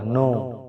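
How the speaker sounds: "No" with silent k and w, just like no; "No" pronounced exactly like the word no; know said as no. Shown as a demonstration. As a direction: say "No" as plain no, no k, no w. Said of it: "इसलिए इसका pronunciation सिर्फ no होगा".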